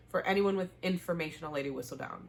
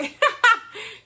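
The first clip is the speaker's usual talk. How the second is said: burst of laughter; 11 dB louder than usual. Real, not acted. Host laughs at own rant.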